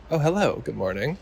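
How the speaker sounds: soft, belated little greeting, re-enacted; taken-aback reply. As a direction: answer softly, just realizing you're being addressed. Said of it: "Then I figured out she was talking to me".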